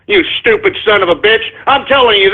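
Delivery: ordinary delivery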